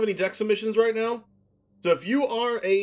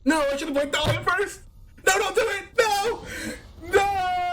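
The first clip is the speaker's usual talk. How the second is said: panicked; 11 semitones higher than usual. Real, not acted. Streamer regrets misplaying Thalia, begs opponent, panicked.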